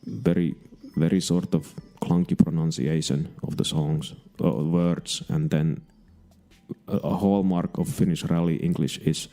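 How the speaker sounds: monotone, clunky Finnish-accented English; Finnish rally accent. Shown as a demonstration. Rally driver holding a press conference, monotone Finnish accent. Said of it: "Let's do a Finnish rally accent".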